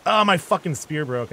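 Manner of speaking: loud frustrated outburst; frustrated shout. Real, not acted. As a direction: yell it as the spear snaps. Spear breaks mid fight.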